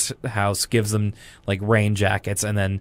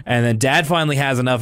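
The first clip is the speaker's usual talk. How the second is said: usual speaking voice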